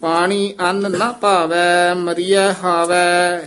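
measured, drawn-out devotional chant; scripture recitation. Shown as a demonstration. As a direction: recite steadily, holding the line endings. (ਠਹਿਰਾਅ ਵਾਲਾ ਗੁਰਬਾਣੀ ਪਾਠ)

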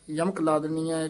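ordinary delivery

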